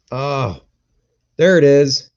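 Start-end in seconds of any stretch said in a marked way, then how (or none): 1.4-2.1 blurted "there", louder, as the picture pops up; loud relieved "there it is". Real, not acted. Streamer finally sees camera picture, loud surprised call. Camera image suddenly appears.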